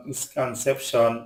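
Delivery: ordinary talk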